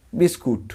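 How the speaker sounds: বিস্কুট with a long "oot" second syllable; mispronounced biscuit. Shown as a demonstration. Teacher showing learners the form to avoid, mispronounced biscuit. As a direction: say বিস্কুট ending in "-koot", no final s. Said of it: "বিস্কুট বলবো না".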